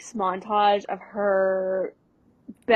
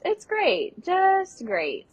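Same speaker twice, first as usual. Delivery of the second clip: high, strained fake enthusiasm; forced cheer; 9 semitones higher, much slower than usual. Lizzie lying to Gordo about her review, high forced cheer. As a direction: pretend it's fine. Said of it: "She goes".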